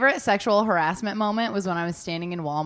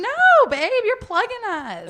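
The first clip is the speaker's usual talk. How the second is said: high mocking voice; 12 semitones higher, 4 dB louder, slower than usual. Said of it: "And I was like".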